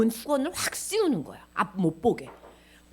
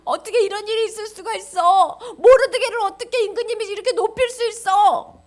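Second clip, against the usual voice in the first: anguished wailing; 10 semitones higher, 7 dB louder than usual. Acted. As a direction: wail in hammed-up despair, nearly crying. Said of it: "하만이 머리가 지근지근 아파서". (괴로워 울부짖는 연기)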